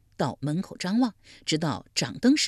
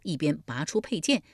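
usual speaking voice

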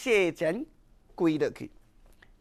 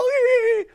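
a high falling sob; a widow's wailing sob; loud sob; 4 dB louder than usual. Acted. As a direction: break into a loud falling cry. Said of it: "用个大声哭了".

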